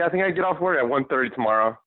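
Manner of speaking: ordinary talk, nothing marked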